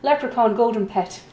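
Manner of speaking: loud, high, hammed-up Irish auctioneer; mock Irish accent. Auctioneer joking with bidders over a leprechaun pet, bad Irish accent. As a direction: put on a terrible Irish accent, belting it out. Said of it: "I should do this one with an Irish accent".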